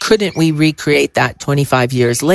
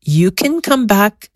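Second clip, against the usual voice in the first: retold guide, pitched up; measured, higher-pitched brush-off; higher-voiced quote; 8 semitones higher than usual. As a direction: give the guide's reply in a higher voice. Quotes the guide putting them off.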